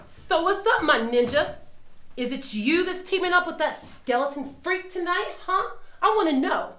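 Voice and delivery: ordinary talk, nothing marked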